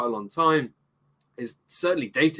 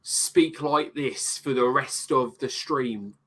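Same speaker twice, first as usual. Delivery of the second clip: slow put-on mock Cockney drawl; 2 semitones higher, much slower than usual. Podcast host mocks Bert's accent in slow Cockney.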